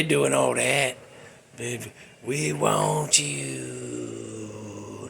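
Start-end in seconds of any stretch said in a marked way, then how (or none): 1.6-5.1 slow, low, drawn-out ghost voice; eerie ghost voice. Pretend. Spirits calling to Sally, slow low moan.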